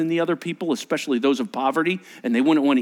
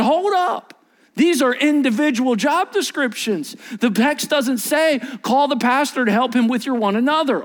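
firm correction; 8 semitones higher than usual. Acted. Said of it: "And God's going".

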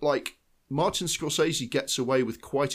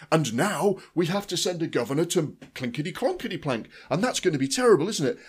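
higher, hammed-up school-play oratory; school-play speechifying; 6 semitones higher, 3 dB louder than usual. Critic mocking big expository speeches in a film, hammed-up school play. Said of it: "it sounds like the school play".

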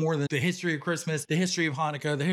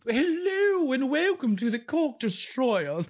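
put-on British accent; 5 semitones higher than usual. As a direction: greet the audience in an exaggerated British accent. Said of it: "stop doing the accent".

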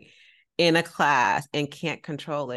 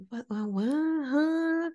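lost newcomer's puzzled questioning; baffled; 13 semitones higher, much slower than usual. Retold or imitated. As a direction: act out total confusion, rising to a higher huh. Confused newcomer in yoga class.

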